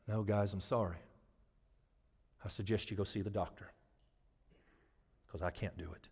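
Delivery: low, very soft, deflated; acted sheepish admission of failure; defeated apology. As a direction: mumble it low and small. [name] apologising that he can't heal.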